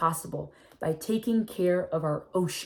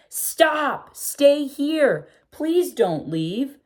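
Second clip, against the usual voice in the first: higher, louder call after the fish, slowing on the last plea; pleading call; 9 semitones higher, 5 dB louder, much slower than usual. Acted. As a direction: call out to the fish begging them to stay. Girl calls to leaving fish.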